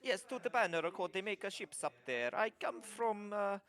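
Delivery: lightly voiced kobold with an Italian lilt, fretting; worried little trader dreading his papa's anger; mock Italian kobold, mildly upset; kobold's fretful accent. Kobold tells of his journey.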